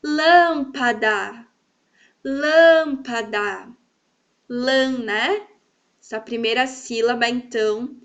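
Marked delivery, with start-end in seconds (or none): 0.0-1.5 "Lâmpada" called slowly with a long, strong LÂM; drawn-out "Lâmpada", weight on "lâm"; calling out. Shown as a demonstration. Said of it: "Vamos chamar cada uma". 2.2-3.7 "lâmpada" called louder and slow, LÂM held long; slow call of "lâmpada", stressed on the first syllable. Shown as a demonstration. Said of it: "Vamos chamar cada uma".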